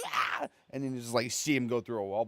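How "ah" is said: short high squeal; high-pitched squeal. Retold or imitated. Man thrown by a ghost lets out a high squeal. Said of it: "a really high-pitched squeal".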